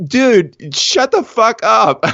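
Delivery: high-pitched, drawn-out disbelief; hammed-up incredulous outburst; mock exasperation. Teller replaying his reaction to the mail carrier, mock exasperated.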